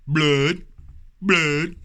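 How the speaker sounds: loud, high cry of blood. Real, not acted. Vlogger showing his cut to viewers, loud and high. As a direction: shout blood at the camera.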